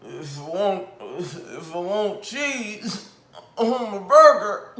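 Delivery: high, loud, slow mocking voice of Broner; mock Broner quote. Mocking the boxer's interview line, high and slow. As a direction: parody him bragging, laughing through it. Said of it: "And then you said".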